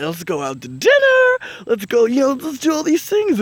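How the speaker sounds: high, eager and bubbly; eager party voice. Retold or imitated. Generous friends inviting you out, bubbly and high. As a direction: chirp it out like everyone's generous. Generous people under Jupiter in Leo.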